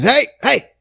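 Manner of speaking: old man calling out his window, mock yell. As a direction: holler down at him. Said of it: "And he fucking".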